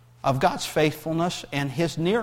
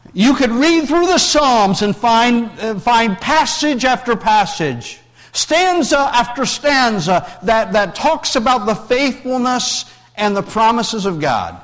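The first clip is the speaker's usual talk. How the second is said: preaching; 6 semitones higher, 5 dB louder than usual. Acted. Preacher building to full force.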